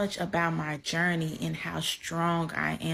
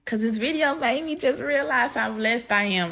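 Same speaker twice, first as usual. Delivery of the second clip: higher, louder, voice welling up with tears; fighting back tears; 6 semitones higher, 4 dB louder than usual. Real, not acted. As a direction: push through the words while trying not to cry. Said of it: "it's because I'm trying not to cry".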